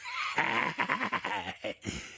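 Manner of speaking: high breathy cackling laugh; lecherous cackle. Acted. Ghost boasts of his poetry, lecherous cackle. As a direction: cackle at your own cheek.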